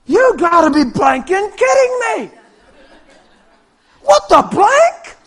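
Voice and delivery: high, loud, acted amazement; incredulous shout. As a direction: yell in comic disbelief. Acting stunned people after healing.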